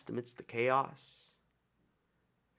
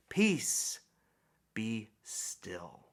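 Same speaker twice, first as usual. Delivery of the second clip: calming command; 3 semitones lower, much slower than usual. Retold or imitated. Quoting Jesus calming the storm.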